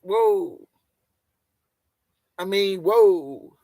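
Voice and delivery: high, drawn-out whoa; stretched astonishment; slow amazed whoa. Real, not acted. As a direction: let the whoa hang in awe. Amazed reaction.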